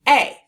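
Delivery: "A" said as a short loud uh; schwa sound. Shown as a demonstration. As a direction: call it out as uh. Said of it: "let's say it as the schwa sound".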